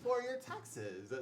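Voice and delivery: high, loud product reveal; shopping-channel host. Acted. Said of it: "That's the one".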